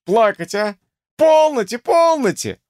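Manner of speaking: put-on loud, high sing-song chiding as to a little child; tut-tut and hush in an exaggerated coddling voice; professor's tut-tutting. A narrator's reading. Professor chiding a weeping patient, patronizing tut-tutting. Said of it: "воскликнул профессор".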